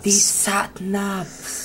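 very slow, hushed Welsh impression; soft, lingering Welsh character voice; Uncle Bryn. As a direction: stretch it out softly as a Welsh uncle. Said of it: "do Uncle Bryn when he used to go".

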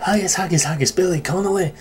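practice words in a put-on Scottish accent. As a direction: rehearse the Scottish sound to yourself. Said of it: "in a Scottish accent".

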